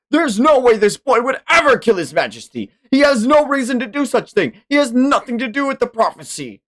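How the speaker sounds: a high voiced outraged knight; indignant defender. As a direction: argue hotly for the boy's innocence. Voicing defender of the boy.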